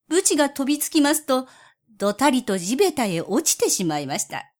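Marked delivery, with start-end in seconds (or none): none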